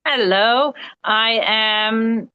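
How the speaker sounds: normal talking voice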